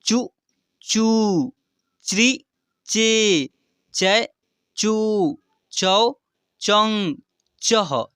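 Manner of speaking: measured syllable drill, short and long vowels alternating; rhythmic recitation. Shown as a demonstration. Teacher sets a rhythm for learners, rhythmic recitation. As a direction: recite each syllable separately in a steady lilt. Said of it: "एक लय बना रहा हूँ".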